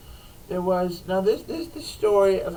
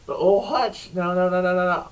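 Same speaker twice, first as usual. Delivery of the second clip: usual speaking voice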